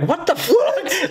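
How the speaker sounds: very high, drawn-out retold disbelief; incredulous outburst. Storyteller replies to guard's crude question, incredulous. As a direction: squeal it slowly in comic disbelief, near laughing.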